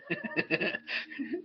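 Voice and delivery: high, helpless laughter; long laugh. Real, not acted. Comedian asks for a non-bird related question, laughing. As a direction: crack up at your own line.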